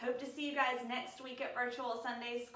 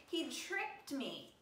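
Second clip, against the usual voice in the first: exclaiming; 6 semitones higher, much slower than usual. Acted. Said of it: "exclaimed Eve".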